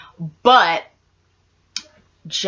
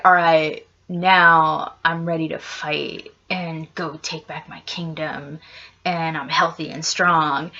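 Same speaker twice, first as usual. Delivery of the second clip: a lower, heavier Ragnar; deep Ragnar voice; 6 semitones lower, 3 dB quieter than usual. Retold or imitated. Ragnar announces his return to reclaim the kingdom, lower voice. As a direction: talk low like a weary warrior king back to fight.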